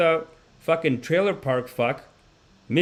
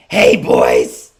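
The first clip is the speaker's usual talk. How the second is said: much lower, loud raspy-smoker greeting; smoker's voice; 12 dB louder than usual. Retold or imitated. Older smoker wife greeting the boys, low loud. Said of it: "she has the most cigarette-y voice".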